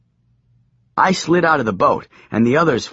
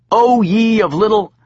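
preachy; 10 semitones higher, 5 dB louder than usual. Acted. Preaching while dragged off.

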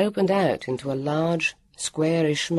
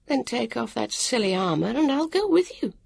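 a busy stranger explaining offhand; harassed woman of the future; 9 semitones higher than usual. A narrator's reading. Woman explaining to the children, brisk and higher.

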